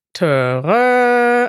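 high, loud trumpeting call; elephant trumpet. Shown as a demonstration. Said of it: "spontan würde ich sagen".

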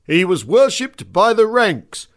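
put-on old music-hall reciter, slow and loud; mock dramatic recital. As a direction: ham up a grand recitation. Reciting a dramatic monologue on stage.

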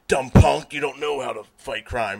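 loud, bullying mock superhero; Batman. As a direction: bark it like a hammy tough vigilante. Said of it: "Batman and Batman Spawn dialogue".

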